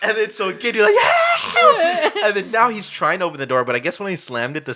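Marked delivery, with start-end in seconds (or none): 1.0-2.2 very high mock scream; hammed-up shriek for rescue; panicked cry for help. Retells Devin's cry for help.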